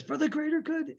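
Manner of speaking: high, breathless and plaintive; imitating Saw. Host quotes Saw again, high and plaintive. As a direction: lift it high with a wheezy sigh. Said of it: "plaintive, sing-songy".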